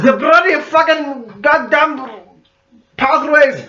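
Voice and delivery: slow Scottish dwarf grumble; dwarven Scottish accent. Pretend. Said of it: "dwarven accent".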